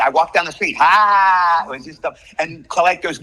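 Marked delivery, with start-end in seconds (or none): 0.8-1.6 one loud, higher, drawn-out "haaa"; long stupid laugh. Shown as a demonstration. Actor re-enacting his first try for the director, long stupid laugh. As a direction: stretch one silly laugh syllable out. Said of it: "It was a stupid little laugh".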